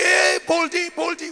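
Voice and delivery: high, loud jeering call; taunting shout. Retold or imitated. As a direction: yell it at him like a rude kid. Kids calling out mockingly.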